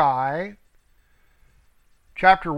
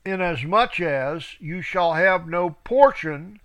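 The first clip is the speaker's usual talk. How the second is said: scripture reading. Acted. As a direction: read it out like a preacher. Reads scripture aloud.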